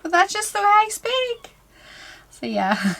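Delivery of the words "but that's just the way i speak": squeaky high indignant retort, far higher; mock protest. Answering the critic of her fillers, in a high mock whine. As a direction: toss off a whiny high comeback. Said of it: "I'm like".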